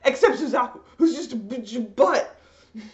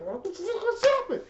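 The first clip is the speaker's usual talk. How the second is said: mock shock; 8 semitones higher than usual. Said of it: "you're just like".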